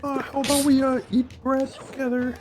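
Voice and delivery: very high, hesitant awkward suitor impression; Scott Pilgrim. Asking Crystal to eat bread together, high timid mock voice. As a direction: pitch it way up, awkwardly asking someone out. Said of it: "Scott Pilgrim voice, yeah".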